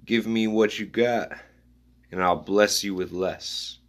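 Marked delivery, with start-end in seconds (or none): none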